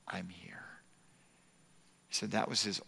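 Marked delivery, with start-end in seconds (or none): 0.1-0.6 soft tender whisper; whispered comfort. Retold or imitated. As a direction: breathe it to him gently. Repeating the nurse's comfort.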